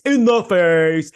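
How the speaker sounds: loud, high, drawn-out repeat; outraged emphasis. Real, not acted. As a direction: hammer it out in loud indignation. Outraged repeat of the previous line.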